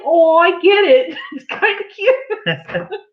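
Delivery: mock dawning delight, drawn out; delighted realization. Wife retells getting the thumbnail joke, delighted.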